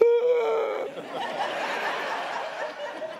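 long high rising gasp of wonder; long excited gasp. Retold or imitated. Telling his wife, he lets out a long high thrilled gasp. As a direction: let the gasp climb with pure excitement. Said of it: "and I told my wife".

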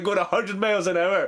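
ordinary talk

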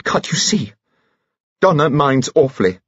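high, upset protest; hysterical. A narrator's reading. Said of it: "please don't be hysterical".

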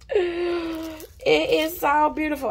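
high, slow, amazed; awed delight. Real, not acted. Vlogger admiring the gift, slow and awed. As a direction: savour it, stretched out in awe.

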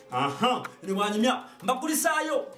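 ordinary talk, nothing marked

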